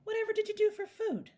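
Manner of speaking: very high, loud question; astonished exclamation. A narrator's reading. Mrs Brown asking the stowaway about food, astonished. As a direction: cry out in amazed concern. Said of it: "exclaimed Missus Brown".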